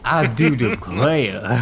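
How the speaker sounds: slow, loud, exaggerated Southern drawl; I do declare like an old Southern gentleman; Southern plantation owner. Offering friends a Southern plantation owner voice, slow drawl. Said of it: "You wanna hear Southern plantation owner?".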